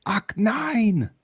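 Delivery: high exclaimed Arnold-style German; Schwarzenegger impression.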